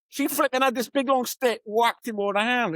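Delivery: ordinary talk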